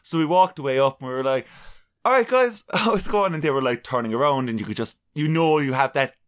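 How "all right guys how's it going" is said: high, bright hammed-up hello; mock cheery greeting. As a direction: greet them with forced cheer. Greeting celebrities, retold.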